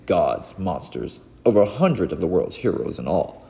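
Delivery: ordinary delivery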